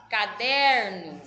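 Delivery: slow, loud Caderno with a strong "der"; stress on the middle syllable. Shown as a demonstration. Teacher showing the class the strong syllable, slow and emphatic. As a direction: stretch Caderno, leaning hard on "der". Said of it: "o der é a sílaba mais forte".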